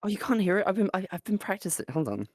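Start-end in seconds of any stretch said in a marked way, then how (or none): none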